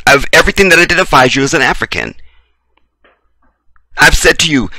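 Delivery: normal talking voice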